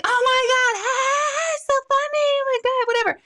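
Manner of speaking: greeting friend Christine in the parking lot, squealing delight; gushing friend greeting. Retold or imitated. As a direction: gush at a friend you just recognized.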